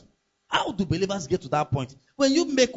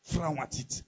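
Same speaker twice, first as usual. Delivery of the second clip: "frown at it" said much softer, low and earnest; softer repeat; 3 semitones lower, 7 dB quieter, slower than usual. Shown as a demonstration. Preacher softly repeating his warning to believers, quiet and earnest. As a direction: drop the voice right down to drive it home. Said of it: "in anything God frowns at".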